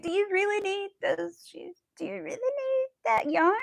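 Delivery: high, mock-questioning voice imitating him; teasing husband. Imitating her husband teasing her about yarn purchases. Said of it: "always his question is".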